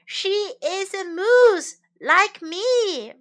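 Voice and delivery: slow, high, loud yell; storybook yell. A narrator's reading. Moose arguing with the deer, yelling. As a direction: yell it out high, stretching the words. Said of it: "Morris yelled".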